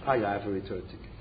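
no rise on ticket, closes downward; answered as a statement. Retold or imitated. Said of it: "Now you say".

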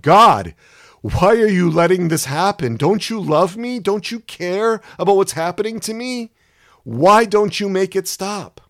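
crying out to God. Retold or imitated. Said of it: "I cried out".

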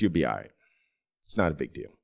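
low, calm fatherly reassurance; reassuring dad. Retold or imitated. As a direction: settle him down like dad.

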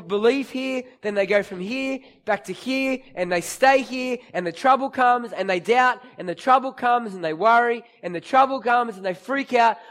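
ordinary talk, nothing marked

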